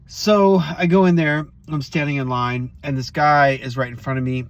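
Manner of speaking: ordinary talk, nothing marked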